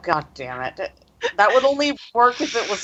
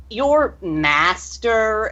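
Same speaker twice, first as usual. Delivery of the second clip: higher, drawn-out quoted words; sarcastic air quotes; 5 semitones higher, much slower than usual. Acted. Iolana refers to their former trainer, in sarcastic air quotes. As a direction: stretch it out in scornful air quotes. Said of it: "she air quotes".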